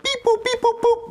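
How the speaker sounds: high beeping robot voice; alien voice. Acted. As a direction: beep it out like a little robot. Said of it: "speak in an alien voice".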